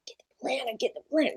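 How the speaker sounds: soft hissed get the planner, like a voice in her head; whispered temptation. Retold or imitated. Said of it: "That's exactly what my voice sounds like".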